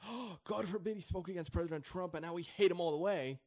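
high, mocking "God forbid"; mock outraged supporter. Commentator parodying upset maga Republicans, high mock outrage. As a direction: lampoon a hysterical fan. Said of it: "getting so emotional about".

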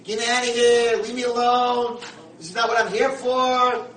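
full-voiced irritated holler; angry outburst. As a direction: yell at him to back off. New roommate angrily rejects the welcome.

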